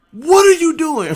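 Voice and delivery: loud, high, slow demand; officer's shout. Retold or imitated. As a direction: bark it at the naked sailor. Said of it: "he said".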